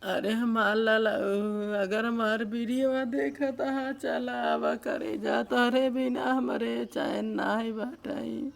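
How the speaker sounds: real wailing plea to an absent husband; crying. Real, not acted. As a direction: sob it out, dragging the last words very slowly. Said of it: "इस तरीके से अगर रो रही हैं". (बिलख-बिलखकर रोते हुए)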